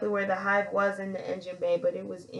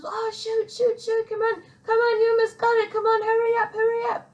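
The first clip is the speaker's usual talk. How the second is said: much higher, hurried mock panic; frantic urging; 13 semitones higher, 3 dB louder than usual. Coworkers urging her to finish fast under fire, high frantic urging. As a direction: egg her on, half laughing in alarm.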